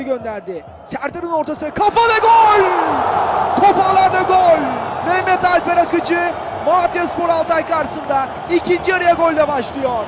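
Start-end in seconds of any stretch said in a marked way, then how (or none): 1.7-10.0 loud, high goal call; goal shout. Real, not acted. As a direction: roar the goal to the crowd. Goal scored, commentator erupts.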